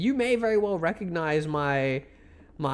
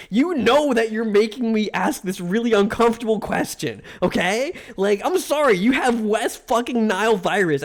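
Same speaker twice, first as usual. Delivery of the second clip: raised pitch, indignant and insistent; worked-up angry rant; 4 semitones higher than usual. Real, not acted. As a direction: go off in an exasperated tirade. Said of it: "I'm so fucking mad".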